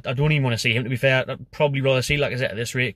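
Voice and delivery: ordinary delivery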